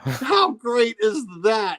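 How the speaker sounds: high, drawn-out excitement; delighted gushing. Real, not acted. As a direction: stretch it out in slow, high-pitched delight. Gushes over the punchline.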